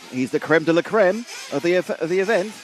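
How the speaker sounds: light mock French accent on creme de la creme. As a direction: say "creme de la creme" the French way, tongue in cheek. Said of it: "love your French accent".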